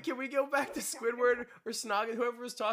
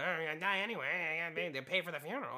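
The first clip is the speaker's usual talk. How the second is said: put-on low, soft croak of an old man; 5 semitones lower, 5 dB quieter than usual. Lightly voiced old man shrugging off death. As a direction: talk like a shrugging ninety-year-old.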